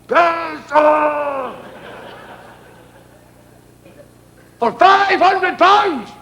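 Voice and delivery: high slow mock shout; crowd shout. The audience shouting back at the ringmaster, high drawn-out shout. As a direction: bellow it as one crowd. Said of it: "they shouted".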